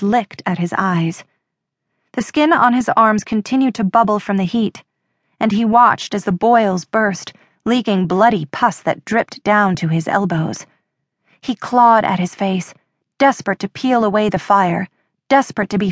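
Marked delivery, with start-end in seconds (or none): none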